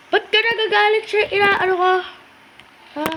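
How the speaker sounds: hammed-up angry shout; shouting back. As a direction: fire it straight back at him. Yelling at an angry customer.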